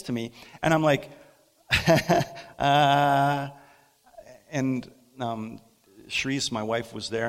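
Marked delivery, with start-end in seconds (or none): 1.7-2.5 breathless laugh. Real, not acted. Laugh while retelling.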